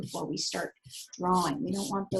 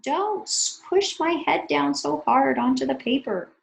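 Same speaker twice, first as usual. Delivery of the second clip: high, louder whiny little marker voice; crying marker; 5 semitones higher, 6 dB louder than usual. Acted. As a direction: plead like a hurt pen being pressed. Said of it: "So Mister Sharpie is crying. He's saying".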